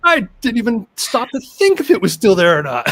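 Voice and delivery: high, loud astonished reenactment; imitating an awed client, loud and high; amazed discovery. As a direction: play her sudden amazed realization. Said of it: "her eyes just went".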